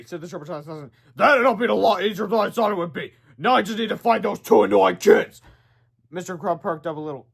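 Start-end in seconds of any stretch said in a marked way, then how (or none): 1.1-5.4 slightly louder villain voice; smug monster talk; lightly voiced robot villain.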